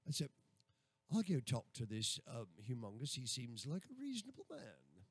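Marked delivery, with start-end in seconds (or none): 1.1-5.0 lower-pitched put-on London accent. Shown as a demonstration. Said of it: "if I was from London".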